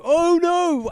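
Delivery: high, loud imitated crowd gasp; mock crowd dismay. Crowd reacting to the fight offer, high and loud. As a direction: play the whole room going oh no. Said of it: "everyone went".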